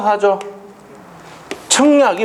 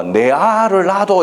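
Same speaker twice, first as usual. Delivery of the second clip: Sol Kyung-gu Gyeongsang impression; 6 semitones higher, much slower than usual. Teacher imitates the Haeundae film line for the class, slow mock Gyeongsang accent. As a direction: stretch it out in a lightly hammed Gyeongsang accent. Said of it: "경상도 사투리로".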